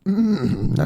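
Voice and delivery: ordinary talk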